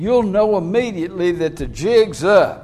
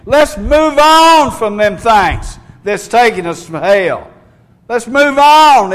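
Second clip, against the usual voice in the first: higher, louder, driving sermon climax; fiery preaching; 4 semitones higher, 6 dB louder than usual. Acted. Preacher urging his flock to move on, in full-force preaching. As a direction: rise up and drive every "move on" home.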